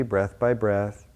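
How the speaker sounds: normal talking voice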